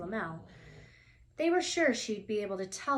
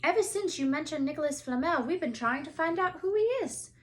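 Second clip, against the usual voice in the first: Harry explaining; 8 semitones higher, 6 dB louder, faster than usual. A narrator's reading.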